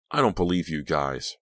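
plain reading voice

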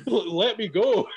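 slow, giggling plea to be released; laughing exasperation. Retold or imitated. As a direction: laugh while begging him off. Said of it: "And I'm like".

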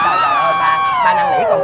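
put-on father squealing a plea at his child; exasperated squeal. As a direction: beg in a high, desperate squeal.

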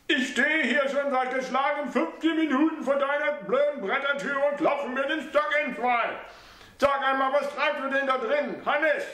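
high mother's voice complaining; scolding old mother. A narrator's reading. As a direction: scold him through the door.

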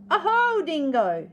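high, loud, fluttery emu greeting; theatrical fluttery delight; fluttering. Acted. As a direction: swoop up high on the oho. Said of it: "she fluttered".

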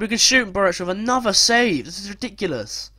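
high-pitched outburst of frustration; real exasperation, voice jumping up; exasperated. Real, not acted. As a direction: protest the save in disbelief. Exasperated at another save in game.

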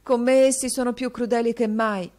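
mild sobbing plea; bullied boy tells his teacher, a touch of sobbing. A narrator's reading. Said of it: "singhiozzò il ragazzo".

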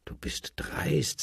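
high, slow, breathless whisper of outrage; whispered rebuke. A narrator's reading. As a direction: hiss it like an offended emperor. Emperor rebukes the Eisenmann.

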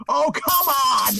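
drawn-out, high exclamation of protest; exasperated cry. Real, not acted. Player protesting a creeper in the game, exasperated cry. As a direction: cry out in exasperation, stretching the words.

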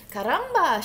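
loud, high exclamation; storybook chicken's loud exclamation; excited cry. A narrator's reading. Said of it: "she cried".

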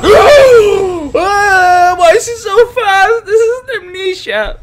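high, loud, slow half-laughing half-crying scream; panicked crying shriek. Real, not acted. Streamer chased by the fast monster, shrieks. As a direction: shriek while fleeing.

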